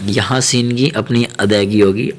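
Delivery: ordinary delivery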